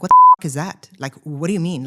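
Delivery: exasperated outburst. Retold or imitated. Mocking vague spiritual advice.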